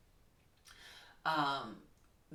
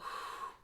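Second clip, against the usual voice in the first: a slow demonstration breath out; breathy out-breath; long exhale sigh; 4 dB quieter than usual. Shown as a demonstration. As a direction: blow the air out audibly. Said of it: "when you breathe out".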